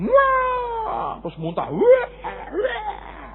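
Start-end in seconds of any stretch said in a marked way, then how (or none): 0.1-1.2 high mock crying wail; child tantrum wail. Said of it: "senjatanya tuh teriak dulu". 1.7-3.3 put-on high child wail; mock a child's screaming tantrum; anak merengek. Anak menangis minta sesuatu.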